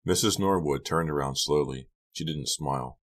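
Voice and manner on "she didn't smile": usual speaking voice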